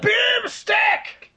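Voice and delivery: high, loud dwarf shout; gruff dwarf voice. Retold or imitated. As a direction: yell it like a Warcraft dwarf. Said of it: "I just did it in the dwarven voice from Warcraft three".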